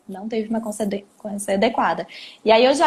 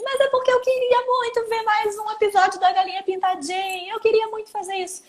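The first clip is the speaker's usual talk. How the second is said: put-on high, pleading little-kid whine; child begging to watch one more episode; whiny child voice; 9 semitones higher than usual. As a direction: whine like a child who wants more cartoons. Child whining voiced in example.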